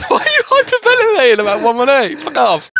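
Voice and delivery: loud, high incredulous yelling at a friend; loud disbelieving outburst; exasperated yell. Real, not acted. Outraged at friend's laugh.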